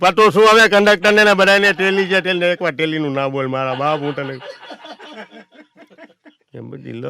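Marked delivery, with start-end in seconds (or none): 0.0-4.3 exasperated outburst. Retold or imitated. Conductor exasperated shouting.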